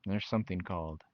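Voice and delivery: usual speaking voice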